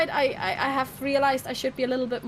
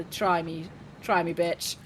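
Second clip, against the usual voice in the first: mock threat; 6 semitones lower, 4 dB quieter than usual. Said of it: "and I go".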